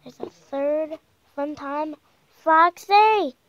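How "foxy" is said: much louder, higher burst on the name; loud exclamation. Real, not acted. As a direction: call out the name in loud surprise. Exclaims at finding a third Foxy.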